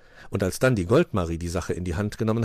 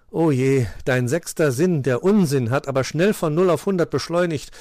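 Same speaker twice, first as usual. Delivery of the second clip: loud, put-upon groan; 7 semitones higher, 6 dB louder than usual. A narrator's reading. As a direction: moan at a friend's silliness. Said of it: "stöhnte Paul".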